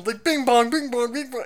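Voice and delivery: high sing-song bing bong; imitating a game show correct chime, high; quiz chime.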